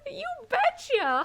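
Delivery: slow, high you betcha through laughter; laughing folksy you betcha; laughing mock drawl. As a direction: play the folksy phrase while cracking up. Laughing imitation of slang.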